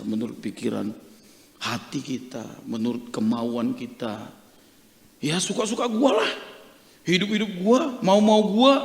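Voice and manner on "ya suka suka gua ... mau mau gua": pitched-up, self-willed retort; acted defiance. Preacher mimicking people who go their own way, defiant. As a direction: insist it's your own life, voice raised in pitch.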